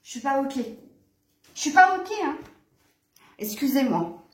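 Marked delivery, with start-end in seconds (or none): 1.5-2.4 high, louder protest to the cat; raised-voice objection. Real, not acted. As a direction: repeat it insistently, pitched well up.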